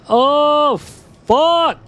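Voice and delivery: sharp, high, loud yelp of shock; real startled outburst; startled gasp and curse. Real, not acted. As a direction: caught off guard, blurting it out. Sudden gasp while gaming.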